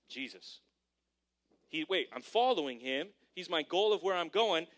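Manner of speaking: usual speaking voice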